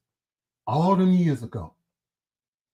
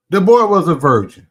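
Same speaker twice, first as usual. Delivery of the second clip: much louder, indignant blast; loud outburst; 2 semitones higher, 10 dB louder than usual. Real, not acted. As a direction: shout it out in outrage. Outraged defence.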